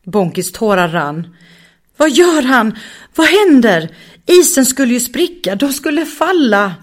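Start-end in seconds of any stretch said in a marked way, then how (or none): 2.0-6.8 high, loud panicked rush of questions; terrified of falling through the ice. Acted. Raga får panik över att isen ska spricka.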